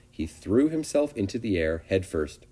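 ordinary delivery

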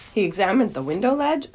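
plain reading voice

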